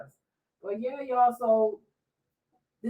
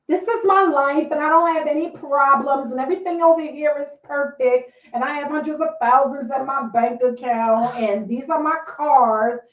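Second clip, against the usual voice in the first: higher, louder boastful influencer voice; mock Instagram show-off; 4 semitones higher, 5 dB louder than usual. Podcaster mocking Instagram influencers, boastful. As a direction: brag like a perfect-life influencer. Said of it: "Instagram to me is like".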